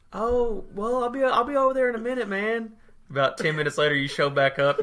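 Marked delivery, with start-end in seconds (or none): 0.1-2.7 much higher, hurried mock friend voice; imitating friend on phone. Retelling friend's reply to a sick caller, high mock voice. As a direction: put on your friend's high-pitched reply. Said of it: "And you were like".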